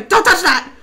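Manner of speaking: high, loud, urgent warning; warning shout. Real, not acted. Player to gnomes near danger, warning shout. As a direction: shout them away from danger.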